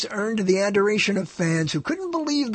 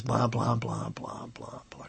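trailing off softer and softer into a whisper; fading to a whisper; 6 semitones lower, 12 dB quieter, slower than usual. Acted. Host dismissing the details, fading to a whisper. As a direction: let the blahs fade away to nothing.

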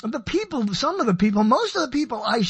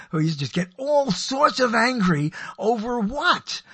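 ordinary talk

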